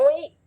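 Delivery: रोई with a rounded, heavy R gliding to ee; wrong heavy R. Shown as a demonstration. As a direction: show the mistake रोई, rounding the R into o. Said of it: "ऐसे नहीं पढ़ना".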